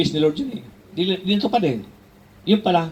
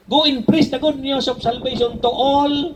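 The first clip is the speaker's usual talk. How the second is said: preaching; 6 semitones higher, much slower than usual. Acted. Great Commission proclaimed loudly.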